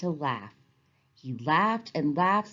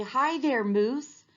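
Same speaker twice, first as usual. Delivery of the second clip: cheerful greeting; 8 semitones higher, slower than usual. Retold or imitated. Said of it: "we can all say".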